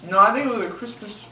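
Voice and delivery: talking while chewing; biting into a chip; mouth full. Real, not acted. Seen 2: Beanie speaker bites a chip at his mouth, then chews.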